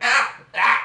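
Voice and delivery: loud high "Ah! Ah!" yelps; loud yelping laugh bursts; mock yelp laugh. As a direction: bark two sharp laughing yelps. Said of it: "'Cause I do that sometimes".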